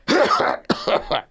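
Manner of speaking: big put-on hacking cough; stronger demo cough. Shown as a demonstration. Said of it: "let me do my cough again. That was weak".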